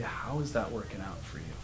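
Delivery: tender, quiet invitation; gentle God. Acted. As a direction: speak it gently like God calling you home. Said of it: "says to us gently".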